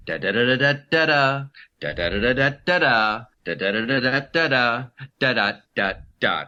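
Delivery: singsong politician cadence in nonsense syllables; mock a politician's lilting rhythm with da da da; hammed-up sing-song stump speech cadence; swing set talking. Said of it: "It's like swing set talking".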